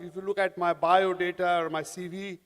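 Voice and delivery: normal talking voice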